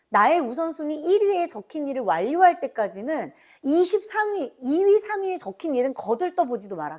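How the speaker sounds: loud, forceful rule recitation; emphatic loud recitation. Real, not acted.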